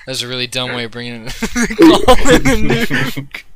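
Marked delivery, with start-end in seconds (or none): 1.8-3.4 high, slow, loud laughing mockery; cracking up repeating it. Real, not acted.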